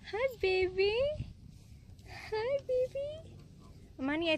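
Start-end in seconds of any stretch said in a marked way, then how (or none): 0.1-1.0 pet talk. Real, not acted. Cooing at animals. 2.3-3.3 squeaky coo to a cow; high pet voice. Real, not acted. Cooing at animals.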